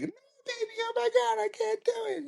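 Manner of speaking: very high squealing falsetto; mock a terrified girlfriend balking; hammed-up falsetto panic; panicking girlfriend. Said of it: "She's like".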